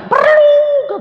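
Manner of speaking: loud, high drawn-out voice of God; emphatic divine answer. Retold or imitated. God answering the doubting preacher, emphatic and loud.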